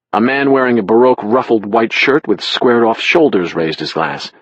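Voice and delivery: ordinary delivery, nothing marked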